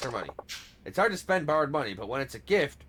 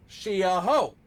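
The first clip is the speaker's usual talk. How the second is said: "She a hoe" punched loud and higher; heavy emphasis; 5 semitones higher, 6 dB louder, much slower than usual. Shown as a demonstration. Showing partner how to say it, "She a hoe" with loud emphasis. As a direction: hit every word of "She a hoe" hard. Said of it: "put the emphasis on it".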